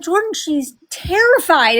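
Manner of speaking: alarmed pleading. Retold or imitated. Grandma pleads with mother, retold.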